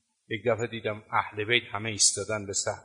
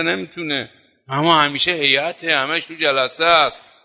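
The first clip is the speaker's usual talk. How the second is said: louder, emphatic sermon delivery; preaching; 5 dB louder than usual. Acted. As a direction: drive the point home to the congregation. Preaching with force.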